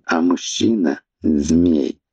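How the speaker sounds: ordinary delivery, nothing marked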